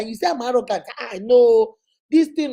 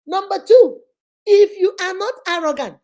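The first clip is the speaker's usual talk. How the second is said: higher and much louder, laying down the rule; firm emphatic mother; 7 semitones higher, 7 dB louder than usual. Retold or imitated. Mother lecturing daughter, loud emphatic second rule. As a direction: drive point two home like a stern mother.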